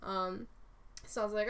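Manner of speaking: ordinary talk, nothing marked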